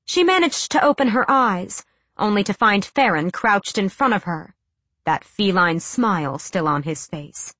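ordinary delivery, nothing marked

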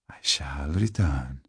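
slow, soft near-whisper; ghostly, drawn-out farewell; hushed parting promise. Acted. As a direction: fade away on a sinister whisper. Vampire's parting promise before vanishing.

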